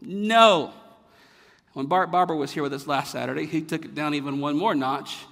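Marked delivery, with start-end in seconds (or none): 0.0-0.7 emphatic no. Shown as a demonstration. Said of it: "it means mega no".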